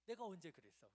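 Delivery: loud, mock-indignant denial; indignant retort. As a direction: throw back a hurt protest, loudly. Acted retort to an exaggerated accusation. (억울한 듯 큰 소리로 따지는 말투)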